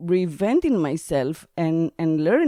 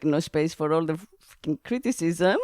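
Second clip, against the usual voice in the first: ordinary talk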